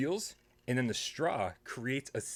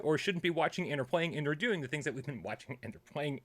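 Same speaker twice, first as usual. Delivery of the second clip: upbeat host intro voice, pitched up; show opener announcer; 4 semitones higher than usual. Acted. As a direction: rattle off the show's opening spiel. Show opener spiel, raised pitch.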